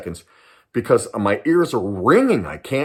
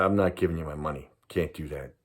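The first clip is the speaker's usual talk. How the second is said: much softer, low, calm refusal; quiet refusal; 7 semitones lower, 9 dB quieter than usual. Retold or imitated. Teen refusing the gunman, quiet and calm. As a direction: say no under your breath. His own refusal to robber.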